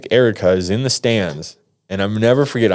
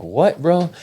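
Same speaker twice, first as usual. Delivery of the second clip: high-pitched retold startled question; startled disbelief; 9 semitones higher than usual. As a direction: squeak out a baffled challenge. Startled reply to threatening inmates.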